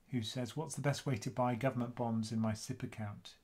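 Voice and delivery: ordinary talk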